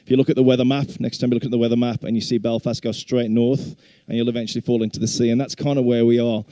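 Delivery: ordinary talk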